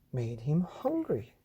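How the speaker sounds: ordinary delivery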